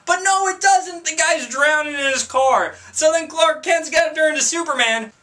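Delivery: high, incredulous comic protest; exasperated outburst. Acted. Outraged at the film's illogic.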